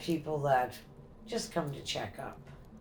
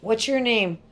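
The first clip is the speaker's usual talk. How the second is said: loud, higher demand to a spirit; calling out to a ghost; 6 semitones higher, 9 dB louder than usual. Real, not acted. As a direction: call out firmly into the room. Questioning a spirit.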